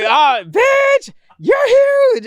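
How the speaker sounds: high-pitched starstruck squeal. Retold or imitated. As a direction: let it burst out high and disbelieving.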